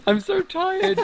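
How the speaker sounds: high squeaky voice, laughing; squeaky tired laugh. Real, not acted. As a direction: giggle it out, high and slow. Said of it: "I do like the squeaky voice".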